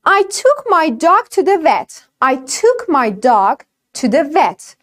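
slow, clear V in "vet". Shown as a demonstration. (تلفظ وی در vet)

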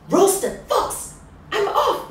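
high, loud panicked fox. Acted. Fox to the mouse, panicking high and loud. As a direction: fox fleeing in fright.

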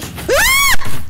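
sudden high startled scream; scream of fright. Real, not acted. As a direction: shriek as the thing jumps out. Sudden jump scare in footage.

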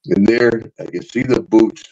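usual speaking voice

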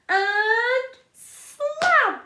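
loud, high slam. Acted. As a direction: call it out with a bang. Slamming door loudly.

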